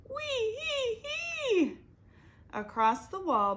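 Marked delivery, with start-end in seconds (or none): 0.1-1.8 very high, slow squealing wee hee hee; squealing laugh. Acted. Abel squealing after the bee sting, high and slow. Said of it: "heard Abel squeal".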